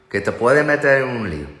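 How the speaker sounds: ordinary talk, nothing marked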